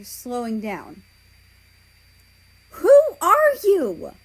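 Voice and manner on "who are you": high, loud, drawn-out yell. Acted. Belarus yells at the approaching figures, high and loud. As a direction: shout it at the strangers. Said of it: "Belarus yells at them".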